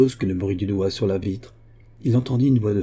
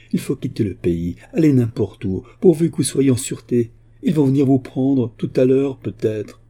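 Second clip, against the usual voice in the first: rising, louder alarmed urging; breathless urgency; 5 semitones higher, 5 dB louder than usual. A narrator's reading. Daughter urges her father to flee, breathless urgency. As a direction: hurry him away without a pause. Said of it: "sans reprendre haleine".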